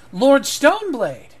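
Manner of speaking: high, loud, drawn-out exclamation; theatrical astonishment. Acted. Eleanor greets the lord in the doorway, hammed-up amazement. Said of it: "Eleanor goes".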